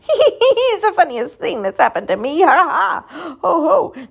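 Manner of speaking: high, loud laughing voice; an elephant breaking into high, loud giggles; giggling elephant. Acted. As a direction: laugh through the words. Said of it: "He began to giggle".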